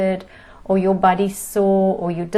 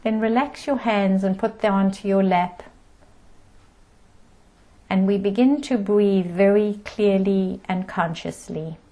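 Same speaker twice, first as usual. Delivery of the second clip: guided meditation. Acted. Teacher settling the group into breath and body, guided meditation. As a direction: ease listeners into stillness, calm and unhurried.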